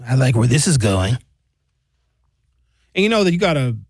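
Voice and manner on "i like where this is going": mock Trump. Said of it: "And Trump was like".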